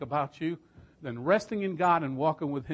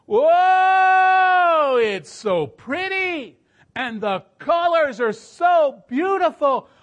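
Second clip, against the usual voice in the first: much higher, loud, slow cry of wonder; awestruck cry; 12 semitones higher, 10 dB louder, much slower than usual. Retold or imitated. Dying mother seeing heaven, loud awestruck wonder. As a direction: gasp it out, overwhelmed by beauty.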